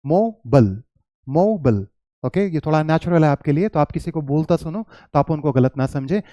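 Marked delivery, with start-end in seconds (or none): 0.0-1.9 each मोबाइल said "MO-bul", on its own; मोबाइल modelled American-style, no eye vowel; American pronunciation. Shown as a demonstration. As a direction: repeat मोबाइल with a reduced "bl" ending. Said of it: "American English में".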